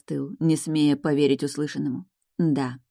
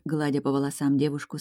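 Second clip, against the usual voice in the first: ordinary delivery, nothing marked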